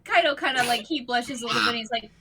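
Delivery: ordinary talk, nothing marked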